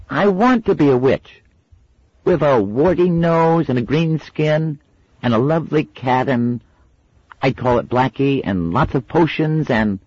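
put-on a six-year-old listing her wishes; eager little girl. A narrator's reading.

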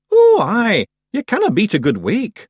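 loud, high little Scots man; cheery Feegle. A narrator's reading. Feegle chief cheering about a funeral. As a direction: cheer for a wake.

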